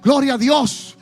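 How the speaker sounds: preaching at full force. Acted. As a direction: build to a shouted climax. Climax of sermon, shouting.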